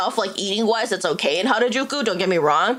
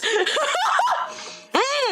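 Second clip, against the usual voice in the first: rising burst of laughter; high laugh. Real, not acted. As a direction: laugh at who is this. Laughs at old voice.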